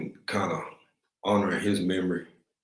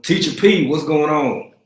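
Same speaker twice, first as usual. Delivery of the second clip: a loud, high, happy greeting to a viewer; excited shout-out; 7 semitones higher, 8 dB louder than usual. Real, not acted. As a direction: call her name out with joy. Greets a viewer joining the stream.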